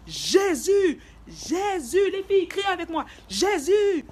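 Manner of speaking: high, loud drawn-out cries; imitating screaming friend. Friend urging the girls to scream along, acted high yell. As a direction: wail the name, rallying the others. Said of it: "elle criait grave".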